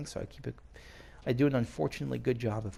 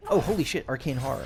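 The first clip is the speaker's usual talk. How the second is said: sudden high, loud shock; real startled outburst; startled exclamation; 3 semitones higher, 7 dB louder than usual. Real, not acted. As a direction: blurt it out, caught off guard. Spotting arcane horror enemy.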